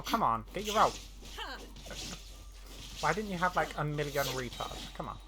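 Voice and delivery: ordinary talk, nothing marked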